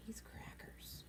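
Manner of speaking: voiceless whisper; scared voiceless whisper. A narrator's reading. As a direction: breathe it so Billy can't hear. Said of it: "Alan whispered".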